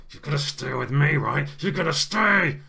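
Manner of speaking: loud, rising to a high drawn-out yell; massively angry. Acted. Woman pinning a stranger's arm, angry shouting. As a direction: build fury to the last word. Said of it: "massively angry really quickly".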